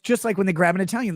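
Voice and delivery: usual speaking voice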